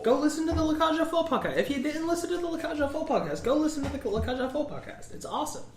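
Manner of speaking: high, fast, excited podcast plug; hyped plug. Acted. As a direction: sell it like an eager announcer.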